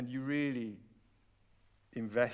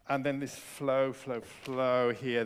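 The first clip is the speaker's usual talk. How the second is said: drawn-out flowing demo; 5 dB louder, slower than usual. Shown as a demonstration. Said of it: "this left hand just needs to flow".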